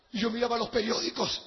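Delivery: ordinary talk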